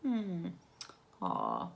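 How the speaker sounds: ordinary delivery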